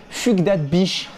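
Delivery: « Fugdat Bish » lu à la française, avec un u français; prononciation très française. Shown as a demonstration. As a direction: dis « Fugdat Bish » sans aucun accent anglais. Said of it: "d'une manière très française, sans accent". (« Fugdat Bish » prononcé comme des mots français)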